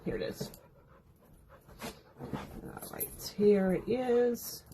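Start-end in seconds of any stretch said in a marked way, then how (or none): 0.0-3.3 whispering. Real, not acted. Muttering to herself while searching.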